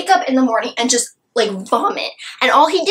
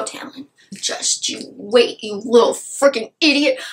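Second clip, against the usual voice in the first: usual speaking voice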